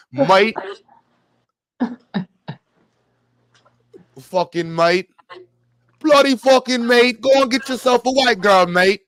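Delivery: put-on a clumsy stab at an Irish accent, shouted; mock Irish accent, loud and high; bad Irish accent. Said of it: "I don't even know how to do their accent".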